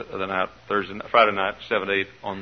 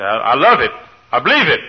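mock proclamation; 2 semitones higher, 8 dB louder than usual. Mock congregant proclaiming faith.

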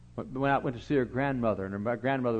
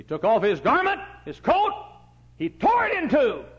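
much higher and louder; peak preaching; 13 semitones higher, 8 dB louder than usual. Real, not acted. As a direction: cry it out at the peak. Climactic preaching.

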